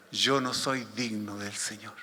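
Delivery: quiet, low, slow inner confession; pensamiento de Pedro. Acted. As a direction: think it aloud, low and slow. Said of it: "Pedro en este momento pensaba". (pensamiento en voz baja, grave y lento)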